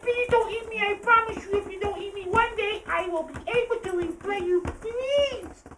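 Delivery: very high tearful squeaky begging; pleading mouse. Acted. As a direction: beg with a tiny trembling voice. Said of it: "the little mouse said".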